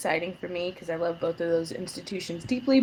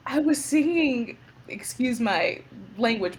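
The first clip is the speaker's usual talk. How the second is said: ordinary talk, nothing marked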